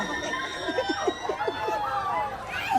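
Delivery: high shrieking laughter on a ride; screaming laugh. Real, not acted.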